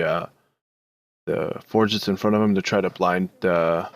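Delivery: usual speaking voice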